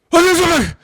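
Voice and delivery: high, loud excited call; excited spotting. Retold or imitated. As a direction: blurt it out like you just spotted a deer. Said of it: "James would be like".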